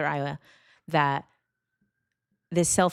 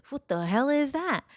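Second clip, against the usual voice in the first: much higher, startled cry; jolted by a sudden noise in the dark; shocked; 10 semitones higher than usual. Retold or imitated. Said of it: "me and the cat both are like".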